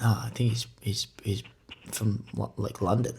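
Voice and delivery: hushed, almost unvoiced; whispering. Real, not acted. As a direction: drop to a whisper. Drops to a whisper.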